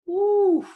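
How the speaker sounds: loud, high-pitched whoop of amazement; high loud "woo". Real, not acted. Host reliving the elbow knockout, amazed whoop. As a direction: let out a big high "whoo".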